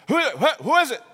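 high, loud stammered question; loud searching call. Acted. Jesus looking for the hidden woman, loud high call. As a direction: shout it out looking around.

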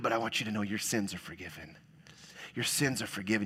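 soft, low reassurance as Jesus; tender reassurance. Retold or imitated. Jesus speaking tenderly to the woman, soft and reassuring. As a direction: gently tell her she is forgiven.

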